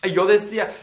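normal talking voice

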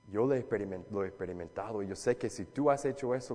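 usual speaking voice

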